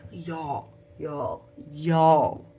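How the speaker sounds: loud despairing y'all; loud dismay. Real, not acted. Vlogger telling viewers about the betrayal, dismayed.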